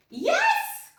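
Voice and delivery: very high, loud yes; witch crying yes. A narrator's reading. Witch welcomes the dog aboard. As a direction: cry out a glad yes. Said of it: "cried the witch".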